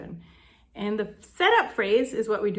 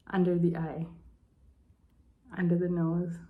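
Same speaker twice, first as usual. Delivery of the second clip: ordinary delivery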